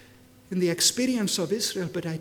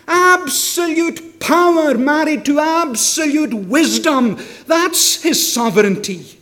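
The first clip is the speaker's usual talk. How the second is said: loud, ringing sermon delivery; full-force preaching; 7 semitones higher, 11 dB louder than usual. Acted. Preacher asks the congregation why Jesus came, preaching at full force. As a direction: preach it out to the whole hall.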